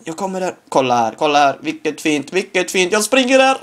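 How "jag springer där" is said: rising high yell into smaka; game excitement; excited shout. Real, not acted. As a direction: shout the goal out. Scoring his own goal.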